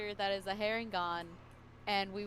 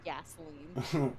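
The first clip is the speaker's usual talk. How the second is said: deadpan gasoline; 6 semitones lower, much slower than usual. Acted. Joke about the drink.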